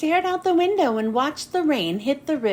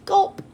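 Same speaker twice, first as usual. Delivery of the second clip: loud nervous swallow sound; nervous gulp; 5 semitones higher, 3 dB louder than usual. Acted. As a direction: gulp it out audibly. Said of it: "I gulped".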